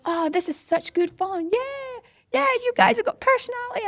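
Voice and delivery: high-pitched gushing imitation; excited. Runners praising the marshals' personality, excited. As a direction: mimic runners bubbling over with delight. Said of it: "And they're so excited".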